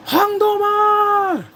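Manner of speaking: loud, high, drawn-out shout; calling out. Acted. Actor calling out to the man he rejected, big acted shout. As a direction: holler the name, stretching it right out.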